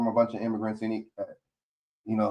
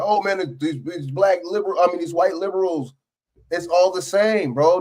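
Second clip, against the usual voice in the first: higher-pitched imitation of a complainer; put-on protest, pitched up; mock complaining; 7 semitones higher, 3 dB louder than usual. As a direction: lightly mimic someone griping. Mimics how conservatives talk.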